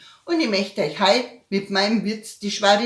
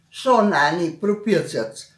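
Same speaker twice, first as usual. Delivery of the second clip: ordinary talk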